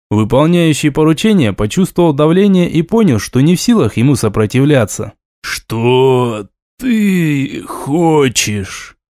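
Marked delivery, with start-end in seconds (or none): 5.4-9.0 very slow, drawn-out words; очень медленно, растягивая слова. Acted. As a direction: stretch every word out, dazed. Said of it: "Очень медленно, растягивая слова". (очень медленно, тягуче)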